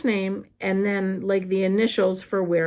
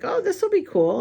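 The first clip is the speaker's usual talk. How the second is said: much higher, lilting mock delight; retold pleased anticipation; 12 semitones higher than usual. Woman expecting the new guy's call, retold high-pitched delight. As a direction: replay naive excitement in a high voice.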